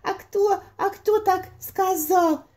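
high, slow squeaky mouse; timid squeaky mouse voice; little mouse voice. Acted. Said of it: "запищал мышонок".

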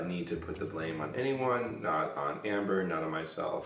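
soft breathy whisper. Shown as a demonstration. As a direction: whisper it close to the mic. Whispered retake of the line.

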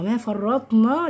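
"ما فرطنا" with heavy f and dark final na; wrong heavy form. Shown as a demonstration. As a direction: mimic "ما فرطنا" all heavy. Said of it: "ما حدش يقول لي".

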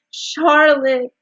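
high, loud wail; anguished moan. A narrator's reading. Wilbur learns his friend is dying, moaning. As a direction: moan the name out in grief. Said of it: "he moaned".

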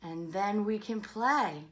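relishing read-aloud, punched for effect; animated story reading. Acted. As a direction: build from calm to a loud comic refusal.

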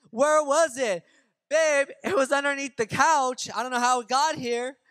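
acted shouting between rooms; calling out. Couple shouting about a found gift card, calling out.

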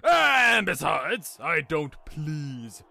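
loud, drawn-out indignant retort; snapping. Acted. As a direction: snap back slowly and emphatically. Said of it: "Doc snapped".

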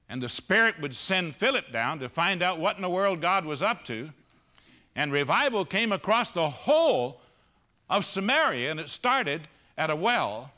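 loud, high, urgent sermon voice; thundering pulpit delivery; full-force preaching. Acted. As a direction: preach it out to the back row. Preaching climax.

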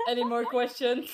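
loud call to the whole room; yelling across the room. Real, not acted. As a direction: call it out so the back row hears. Calling out to the courtroom.

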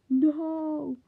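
loud, high, drawn-out "no"; dismayed cry. Pretend. Vlogger reacting to their own old book, dismayed cry. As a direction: wail it out in exaggerated dismay.

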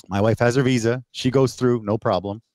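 usual speaking voice

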